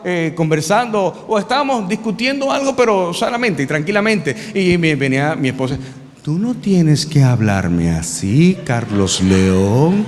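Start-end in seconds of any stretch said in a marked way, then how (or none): 6.2-10.0 much lower, very slow reprimand; wife scolding Carlos León slowly; stern wife scolding. Retold or imitated. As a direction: drag out his full name like a warning.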